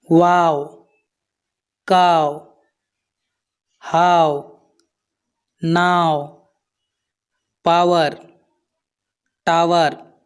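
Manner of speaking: वाओ, काओ, हाओ, नाओ, पावर, टावर with a drawn "ah-o" glide. Shown as a demonstration. Teacher drilling learners, "ah-o" glide in वाओ through टावर. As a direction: say वाओ to टावर apart, gliding from "ah" to "o". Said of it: "यहाँ पर इसका उच्चारण होता है आओ".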